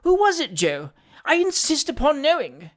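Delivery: put-on high, agitated old woman's demand; insistent old lady. A narrator's reading. Old lady ordering Joe to tell, insistent.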